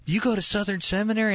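high-pitched imitation of a Southern woman; Southern accent. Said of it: "kind of a Southern accent".

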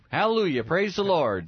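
loud, high mock-jubilant cheer; acted rejoicing. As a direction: exclaim it like a revival shout. Father's acted rejoicing at son's news.

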